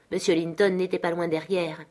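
ordinary delivery

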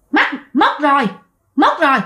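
a possessed neighbour cackles over the loss; possessed woman gloating. Retold or imitated. Possessed neighbour taunting the family, eerie gloat. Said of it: "cười hả hả hả".

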